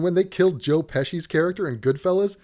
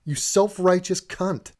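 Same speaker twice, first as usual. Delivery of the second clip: mock angry retort; 3 semitones higher, much slower than usual. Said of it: "The only response to that is".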